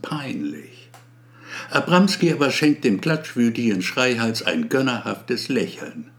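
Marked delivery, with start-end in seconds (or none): none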